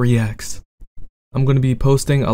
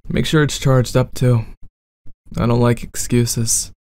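bad boy roleplay.

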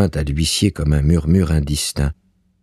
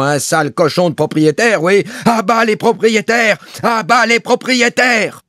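furious high shouted slogan; vociferating; 3 dB louder than usual. A narrator's reading. Bailiff throwing the landlord out, vociferating in rage. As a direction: yell it wildly, voice way up. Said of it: "vociférant".

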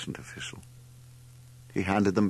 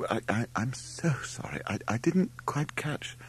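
high, stammering, apologetic; flustered apology; 6 semitones higher than usual. A narrator's reading. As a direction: stammer politely, at a loss. Mother desperate, apologising, still baffled.

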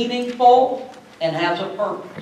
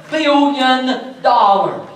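ordinary talk, nothing marked

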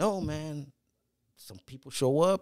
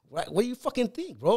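higher, incredulous retort; mock exasperated; 9 semitones higher than usual. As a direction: throw it back like it's obvious.

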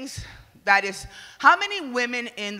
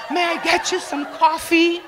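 a high, louder, glamorous commercial voice; mock shampoo ad; 7 semitones higher, 5 dB louder than usual. Comedian spoofs a hair commercial, high and glamorous. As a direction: play the hair model breezily offering coffee.

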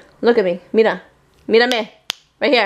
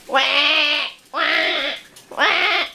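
mock crying; 7 semitones higher, much slower than usual. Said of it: "And you start crying?".

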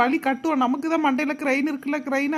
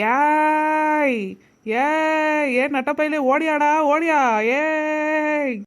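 loud, long-held calls; drawn-out insistent summons; hollering; 2 semitones higher, 4 dB louder, much slower than usual. Acted. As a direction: bellow for someone who will not come. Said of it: "எப்படி சத்தம் போறா".

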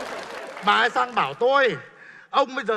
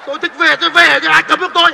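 loud, higher, indignant pushback; defiant retort; 5 semitones higher, 9 dB louder than usual. Acted. Young man angrily defying the retired boss. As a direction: stand up to him, getting louder.

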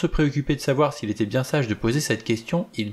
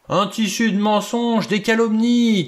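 higher and louder, slow outraged thunder; 11 semitones higher, 5 dB louder than usual. Acted. The general rejects the accusation before everyone, thundering. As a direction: roar out the denial like an old general. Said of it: "tonna MacArthur".

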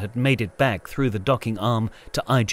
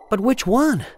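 anxious question; 12 semitones higher than usual. A narrator's reading.

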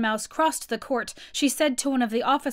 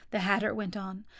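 usual speaking voice

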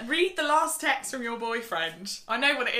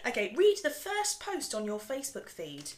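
ordinary talk, nothing marked

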